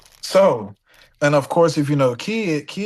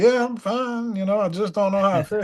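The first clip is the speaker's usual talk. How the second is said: dazed Jerry; 6 semitones higher than usual. Retold or imitated. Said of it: "Jerry's like".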